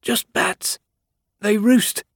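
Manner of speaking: high, wheezy gasp of a word; wheezing. Acted. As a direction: force it out short of breath. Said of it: "Freed wheezed".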